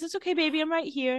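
high, imitated soothing mom voice; motherly reassurance. A revived mother comforts her crying daughter, motherly reassurance. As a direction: lightly act a mother comforting her child.

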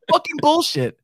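high-pitched comic protest; mock indignant outburst. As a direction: throw it out high, imitating outrage. Acting out the actor's indignant outburst.

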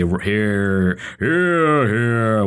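mock Lords cheering hear hear; imitating a rowdy chamber; parliamentary cheering. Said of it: "vote once or twice and say".

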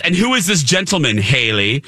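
normal talking voice

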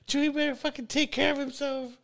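high, mocking imitation of a scolding friend; imitating a stern friend. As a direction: squeak it out like a nagging friend. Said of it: "you're like".

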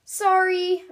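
high, slow sheepish apology; sorry, stretched out and meek; sheepish dino. A narrator's reading. Bronte apologizing after knocking over Surfer Girl. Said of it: "Bronte says again".

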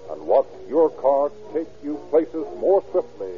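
ordinary delivery

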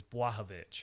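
"Błahovic" with ł as a w; W sound. Shown as a demonstration. Host teaching co-host a Polish surname, "Błahovic" as BWAH-ho-vitch. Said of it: "It's a W sound".

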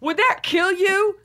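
high, exasperated mock pleading; mock indignant demand. Shopper demanding a bigger discount from Sephora, mock indignant.

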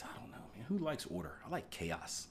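whispered answer to a viewer's question; whispered joke. Acted. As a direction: whisper it like a secret.

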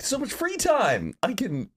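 playful imitation of night-owl glee; mock gleeful thought. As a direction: gush over the free hours. Voicing humans' bedtime thinking.

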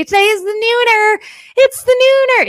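high, teasing sing-song on nooner; playful announcement. Acted. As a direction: sell the show name with a grin. Playful show title announcement.

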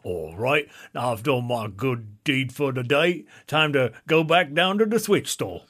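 badly faked British accent; terrible put-on British accent. Passerby heads back to Switch store, terrible British accent. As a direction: lay on a clumsy British accent. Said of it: "God, that's a terrible accent".

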